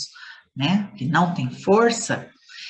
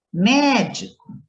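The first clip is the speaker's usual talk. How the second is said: "médico" with MÉ drawn out, high and louder; stress on first syllable; 5 semitones higher, 3 dB louder, slower than usual. Shown as a demonstration. Teacher showing pupils the strongest syllable, stressed MÉ in "médico". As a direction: punch and stretch the MÉ in "médico". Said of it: "pronunciadas com mais força".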